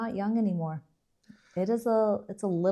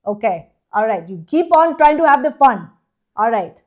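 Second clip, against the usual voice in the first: loud, high, hammed-up Indian accent; imitation Indian accent; 5 semitones higher, 8 dB louder than usual. Shown as a demonstration. Co-host addressing everybody for the public, loud put-on Indian accent. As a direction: exhort the crowd in a mock Indian accent. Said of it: "that good of an Indian accent".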